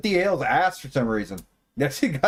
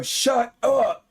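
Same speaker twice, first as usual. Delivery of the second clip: sharp, higher mock yell; a quick retold scream into the mic; imitating a yell; 7 semitones higher than usual. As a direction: echo her shout back at them. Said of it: "super loud".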